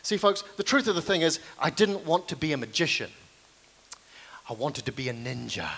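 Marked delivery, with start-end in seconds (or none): 4.5-5.7 hushed, earnest reveal played for laughs; mock-solemn confession. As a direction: lower and softer, for dramatic effect. Solemn mock confession after pause.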